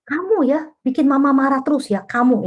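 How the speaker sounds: accusing mother. Shown as a demonstration. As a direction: point the finger with the word kamu. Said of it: "ini anak ya". (ibu menuduh anak dengan nada tinggi)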